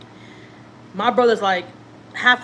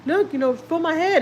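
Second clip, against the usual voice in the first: tearful plea; 7 semitones higher than usual. Retold or imitated. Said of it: "'cause I started crying".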